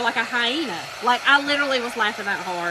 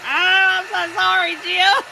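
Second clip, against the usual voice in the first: high, loud mock-remorseful cry; retold apology on the edge of laughter; apologetic wail; 6 semitones higher, 6 dB louder than usual. Retold apology while breaking into laughter.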